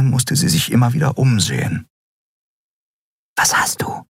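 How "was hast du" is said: hushed, voiceless question; whisper. A narrator's reading. Companion asks the captain what is wrong, whispering. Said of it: "flüsterte Nelen".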